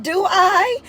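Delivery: lightly high, Tennessee country lilt; a touch of a Tennessee country accent. Retold or imitated. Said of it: "they Tennessee country accent".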